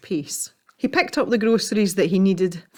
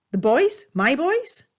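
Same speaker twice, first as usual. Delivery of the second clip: surprised mother; 8 semitones higher, slower than usual. Acted.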